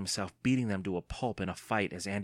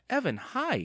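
calling out; 3 dB louder than usual. A narrator's reading. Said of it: "she called".

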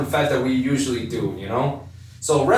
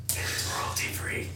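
soft whispered aside; whispering; 10 dB quieter, slower than usual. Pretend. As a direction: whisper it like a secret. Joking aside about royalty free.

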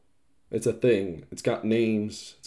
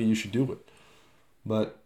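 usual speaking voice

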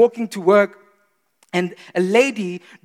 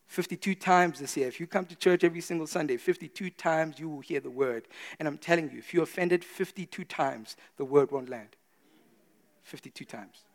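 quiet emphasis; 4 semitones lower, 10 dB quieter than usual. Real, not acted. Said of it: "quiet emphatic sermon".

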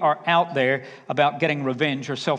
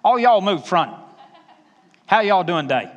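loud down-home Alabama greeting; Southern Alabama holler to a crowd; Alabama accent; 5 dB louder than usual. Shown as a demonstration. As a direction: call out in a broad Alabama accent. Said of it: "when I get back to Alabama".

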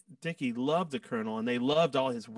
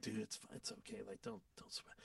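much softer, fading into a whisper; hushed consoling; 4 semitones lower, 19 dB quieter than usual. Retold or imitated. The guys quietly console Jimmy after Greg's rebuke, hushed. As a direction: soothe a crushed friend under your breath.

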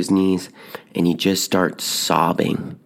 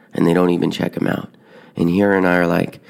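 ordinary delivery

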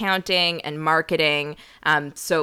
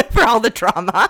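laughing; 10 semitones higher, 7 dB louder than usual. Real, not acted. Laughing apology to audience.

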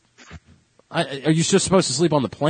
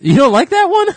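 surprised; 13 semitones higher, 8 dB louder than usual. Real, not acted. Surprised at cohost's dislike.

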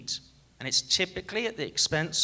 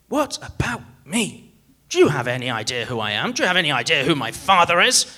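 mock hissy fit; 6 dB louder, faster than usual. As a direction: pretend entitled passenger, fast and louder. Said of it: "we do not throw a hissy fit".

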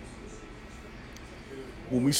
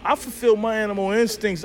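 usual speaking voice

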